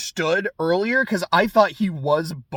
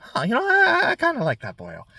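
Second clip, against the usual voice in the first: Jack Slash; 7 semitones higher, faster than usual. Acted. Villain takes a liking to the boy's manners, attempted Irish accent. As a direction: muse aloud in a playful Irish accent. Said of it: "if I'm trying to do an Irish accent".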